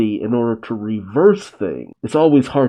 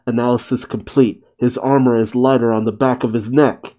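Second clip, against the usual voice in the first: plain reading voice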